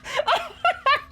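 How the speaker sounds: very high, squealing laugh. Real, not acted. Streamer cracking up at the game, squealing laugh. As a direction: burst into high-pitched laughter.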